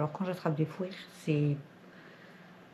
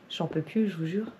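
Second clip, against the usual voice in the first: ordinary delivery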